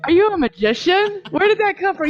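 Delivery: high excited disbelief; amazed. Real, not acted. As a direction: gush with amazement, voice up. Amazed by the cowbell.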